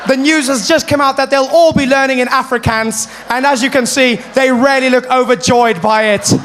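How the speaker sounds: high, loud reporter delivery; hammed-up live field report; foreign correspondent. Correspondent in Soweto reporting to Mary, hammed-up. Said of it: "foreign correspondents".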